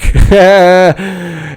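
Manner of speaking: real, helpless giggle; amused laugh. Real, not acted. Host amused by a silly couple nickname, real laugh. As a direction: crack up at your own joke.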